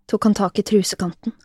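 plain reading voice

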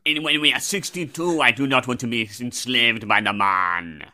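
poor, labored mock French; stretched-out fake French; French son accent. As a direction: protest with a bad French accent. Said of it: "So you're my French son".

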